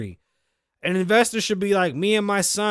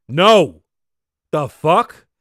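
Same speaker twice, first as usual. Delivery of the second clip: loud indignant no; outraged; 8 dB louder, much slower than usual. Real, not acted. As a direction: bark it out in disbelief. Outraged at the school.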